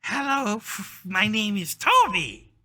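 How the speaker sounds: high, loud, drawn-out echo; parroting kobold. Acted. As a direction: copy a phrase slowly in a creature voice. Kobold parrots the newcomer's introduction.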